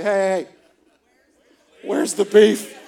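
loud, proud punchline call; gleeful dad joke. Pretend. Dad calling the waiter over for his joke, loud and gleeful. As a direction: crow the old joke at the waiter. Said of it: "with a glimmer in your eye".

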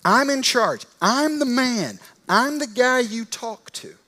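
loud, high, boasting "I'm in charge"; puffed-up authority. Retold or imitated. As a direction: swagger and bully. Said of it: "He's trying to get Jesus' attention".

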